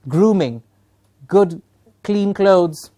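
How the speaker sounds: good clean clothes, drawn out word by word; slow emphatic list. Shown as a demonstration. Trainer spelling out grooming rules for teachers, slowly. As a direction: lay each word down slowly.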